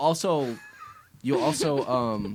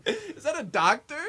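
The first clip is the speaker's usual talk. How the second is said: amused puzzled; 12 semitones higher, slower than usual. Real, not acted. Amused high puzzled guess.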